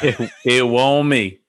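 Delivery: slow Southern drawl of denial; mock-innocent, stretched Southern accent; hammed-up Southern accent. Playing innocent Southern suspect.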